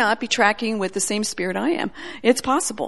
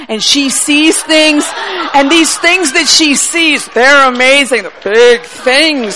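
louder, slow, every word pressed; emphatic insistence; 4 semitones higher, 8 dB louder, slower than usual. Retold or imitated. The sister pressing the girl, loud and slow. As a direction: urge her with big slow weight. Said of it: "And she says".

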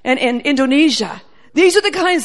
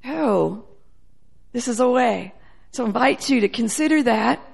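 ordinary talk

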